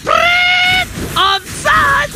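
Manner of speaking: very high whistle then offside shout; referee call. Pretend. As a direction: call it like a football ref. Caster plays football referee.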